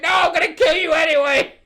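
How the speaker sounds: high, loud imitation of a stubborn kid; hammed-up shouted threat; mock defiant shout. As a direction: shout down the plea, very high-pitched. Acts out Peter refusing, shouting.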